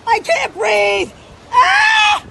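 loud cry, then slow strained words; scream then gasping plea. Real, not acted. Restrained woman screaming that she can't breathe, terrified. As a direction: cry out in pain, struggling for air. Pinned down, crying out.

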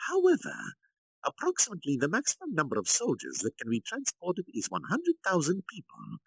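Kalgurio, insisting. Acted. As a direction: press the plan with authority.

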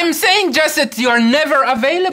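very high, loud accusation; heated, high-pitched outburst; angry shouting. Acted. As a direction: yell it in exasperation at a partner. Acted quarrel, voice raised at partner.

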